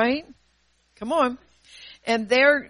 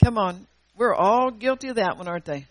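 usual speaking voice

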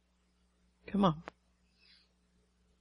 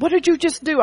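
higher, louder startled question; alarmed daughter; 8 semitones higher, 5 dB louder than usual. Retold or imitated. Preacher retelling her daughter asking in alarm. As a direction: voice the daughter's shock.

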